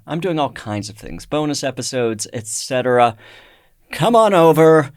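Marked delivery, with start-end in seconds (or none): 3.9-4.9 loud, higher-pitched beckoning call; calling out invitation. Pretend. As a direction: call listeners over with a big inviting holler. Host calls listeners to the Patreon.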